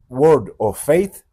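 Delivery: slow, loud attempt at an English accent; bad English accent. Real, not acted. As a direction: say it laboriously in clumsy French-accented English. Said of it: "mon accent anglais, c'est immonde". (mauvais accent anglais, lent et appuyé)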